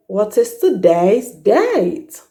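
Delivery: slow, "date" with a full gliding ay; gliding model. Shown as a demonstration. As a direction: glide every ay slowly. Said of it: "You glide".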